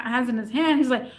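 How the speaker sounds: normal talking voice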